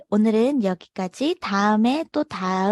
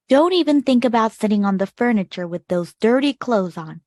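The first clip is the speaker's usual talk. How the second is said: stern scolding parent; 5 dB louder, much slower than usual. Acted. Ogre parents scolding Clementine.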